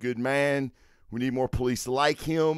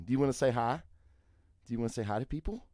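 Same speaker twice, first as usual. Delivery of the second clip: soft coaxing pet voice, much quieter; 9 dB quieter, faster than usual. Real, not acted. Owner softly coaxes his dog to greet viewers. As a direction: coax the dog gently.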